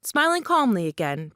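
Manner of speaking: usual speaking voice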